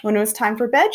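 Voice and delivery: plain reading voice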